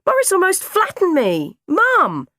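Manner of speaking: put-on high, loud child demanding attention; aggrieved yelling at a parent; indignant shout. A narrator's reading. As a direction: shout to make mum listen. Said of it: "I shouted".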